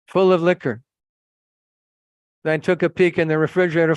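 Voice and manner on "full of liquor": raised, louder emphatic repeat; indignant repeat. Retold or imitated. Visitor repeating what the cabinet held, raised and indignant. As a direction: drive the shocking discovery home.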